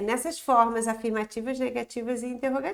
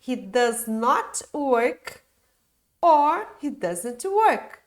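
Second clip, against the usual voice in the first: slow model phrasing; 3 dB louder, much slower than usual. Shown as a demonstration. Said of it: "eu poderia falar".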